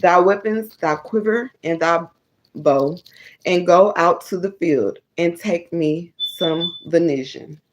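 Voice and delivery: loud, measured Bible reading; emphatic read-aloud of scripture. Acted. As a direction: read the verses out firmly, phrase by phrase with pauses. Reads scripture aloud to viewers.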